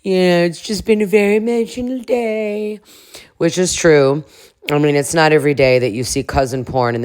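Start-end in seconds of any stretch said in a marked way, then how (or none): 0.0-2.8 mock drunk crying; high, whiny tipsy weeping, badly faked; Angelica. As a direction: imitate an embarrassed drunk girl in tears. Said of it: "so Angelica's drunk crying".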